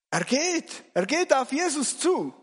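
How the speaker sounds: high-pitched astonished exclamation; amazed excitement. Acted. Rower watches the disciple walk on water, astonished. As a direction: marvel aloud as it happens.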